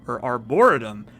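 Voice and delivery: arboretum said as ar-BOR-uh-tum; stress on BOR. Shown as a demonstration. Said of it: "which syllable you put the emphasis on".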